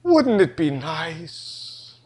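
slow, soft wistful hint; drawn-out longing suggestion; dreamy wife. Retold or imitated. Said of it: "She kinda goes".

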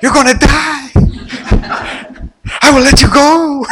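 high, loud, rising panic; panicked Blondin. Retold or imitated. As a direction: yell in mock alarm from the wire. Imagined panicked Blondin shouting.

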